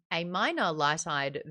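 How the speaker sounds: usual speaking voice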